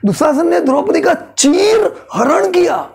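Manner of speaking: चीर हरण punched high and heavy; stress on चीर हरण. Shown as a demonstration. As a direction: land the weight on चीर हरण. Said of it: "सबसे खराब चीज इसमें क्या है? चीर हरण". (चीर हरण पर ज़ोर देकर)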